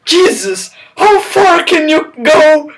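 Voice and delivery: high, loud hammed-up line; mock whisper. Reader ignoring the whisper cue, high and loud. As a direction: shout what should be whispered. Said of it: "he whispers".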